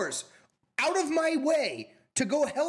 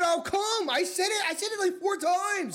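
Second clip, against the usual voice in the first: higher-pitched exasperated protest; insist, voice going up in frustration; exasperated insistence; 9 semitones higher than usual. Real, not acted. Friend protests he offered four times, exasperated.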